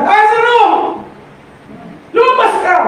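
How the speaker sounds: high, loud call into the tomb; Jesus calling Lazarus out of the grave with full command; commanding shout. Acted. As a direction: ring it out loud and high like a summons. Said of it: "At sumigaw siya".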